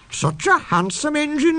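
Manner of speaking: high tittering coaches fussing over a handsome engine. A narrator's reading. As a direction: coo it like giggling gossips. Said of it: "they tittered".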